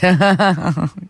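short spontaneous chuckle; amused laugh. Real, not acted. Host reacts to a listener's teasing message, amused laugh.

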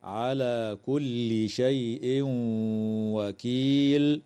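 slow recitation, the tanween of شيء merged into the و of وكيل with a nasal hum; idgham with nasalization. Shown as a demonstration. Teacher reciting the verse in the connected way for students. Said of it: "يدغم التنوين في الواو مع الغنة".

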